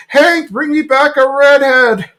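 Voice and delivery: high, drawn-out yell after someone; Bill. Retold or imitated. Bill calling after Hank driving away, high slow call. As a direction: imitate a cartoon buddy calling out. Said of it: "Bill's like".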